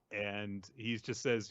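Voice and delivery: ordinary talk, nothing marked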